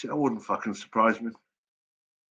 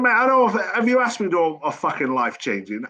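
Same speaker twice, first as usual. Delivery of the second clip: way higher, loud, disbelieving; can't believe what he's hearing; high outraged disbelief at the caller; incredulous; 6 semitones higher, 3 dB louder than usual. Retold or imitated. Incredulous reply to pushy record exec.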